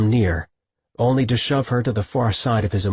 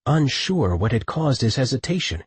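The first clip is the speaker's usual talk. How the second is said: usual speaking voice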